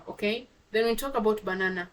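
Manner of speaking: ordinary talk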